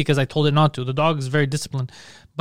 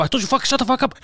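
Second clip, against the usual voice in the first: higher, exasperated order; fed-up yell; 8 semitones higher than usual. Retold or imitated. Man yelling at the cat at four in the morning, fed up. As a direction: lose patience with the cat.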